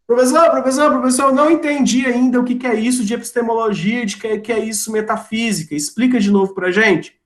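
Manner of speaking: high, hurried mock student plea; confused student. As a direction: act out a pupil pestering the teacher. Imita aluno que não entendeu.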